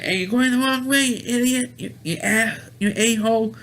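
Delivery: high-pitched heckling of a wrong-way driver; mock cursing out. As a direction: imitate irate strangers yelling, pitched well up. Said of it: "people are cursing him out".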